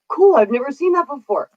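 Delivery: high-pitched, impressed remark; lightly acted awe; retold amazement. Retold reaction to audience clapping.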